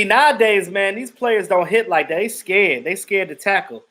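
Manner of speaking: normal talking voice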